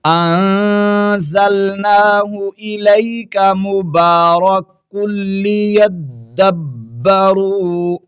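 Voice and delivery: slow, drawn-out melodic tilawat; Quran recitation. Acted. Recites the verse. (ترتیل کے ساتھ تلاوت)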